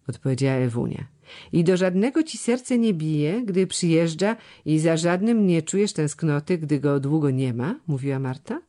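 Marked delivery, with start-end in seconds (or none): none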